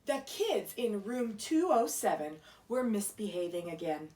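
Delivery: plain reading voice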